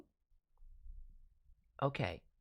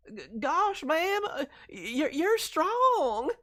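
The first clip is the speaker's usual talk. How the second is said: put-on higher, bashful country boy accent; 13 semitones higher, 9 dB louder, slower than usual. Streamer voicing shy country boy Eddie with a country accent. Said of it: "soft-spoken, innocent country boy accent".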